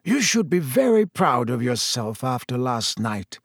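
plain reading voice

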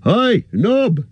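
high call across the inn; shouting for Nob. A narrator's reading. Innkeeper shouts for his servant Nob, high. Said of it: "he shouted".

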